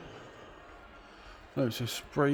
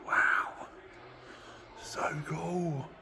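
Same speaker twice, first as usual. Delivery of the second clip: breathless whispered wonder; awed whisper; 5 semitones higher, 7 dB quieter, much slower than usual. Real, not acted. Gazing at the Jaguar up close, whispered awe. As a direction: whisper it like the car might hear.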